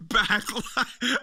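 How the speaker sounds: laughing mock impression of a winded promo; Triple H. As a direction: imitate the breathless announcer, cracking up. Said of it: "Just the tone of your voice".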